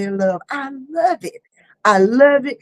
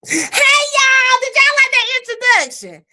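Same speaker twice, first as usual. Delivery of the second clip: excited shouted greeting; 12 semitones higher than usual. Real, not acted. Host greeting viewers after the introduction, high excited squeal. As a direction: call out high and thrilled to the crowd.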